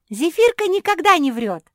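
high, loud exultant cry; jubilant. A narrator's reading. Жози celebrating the safe catch, jubilant. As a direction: exult at full voice. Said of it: "ликовала Жози".

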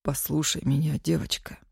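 low, husky near-whisper; hoarse and quiet. Acted. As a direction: hush her with a rasp. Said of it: "хрипло, тихо сказал отец".

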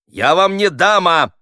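indignant, high, loud protest; slow outraged shout. A narrator's reading. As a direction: raise your voice, offended, stretching the words. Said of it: "повысил голос Хуриев".

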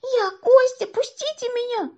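put-on high, loud child's voice; pained squeal. A narrator's reading. Boy under seat answers man, squealing. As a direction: squeal up from below.

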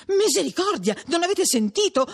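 high, agitated retort; alarmed exclamation. A narrator's reading. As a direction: cry it out while wriggling free. Perpetua struggles free in alarm.

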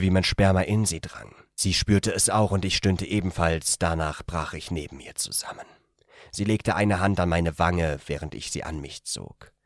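plain reading voice

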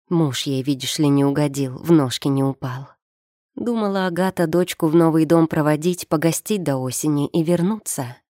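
usual speaking voice

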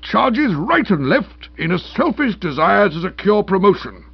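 high, loud magistrate's tirade; strong remarks from bench. A narrator's reading. Magistrate condemning the police before his nephew, outraged. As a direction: thunder indignantly as if from the bench. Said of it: "give Stilton the strong remarks from the bench".

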